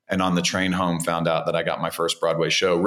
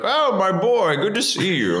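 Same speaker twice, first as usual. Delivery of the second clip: Mike Nichols impression; 10 semitones higher than usual. Director greeting the young actor, high and delighted.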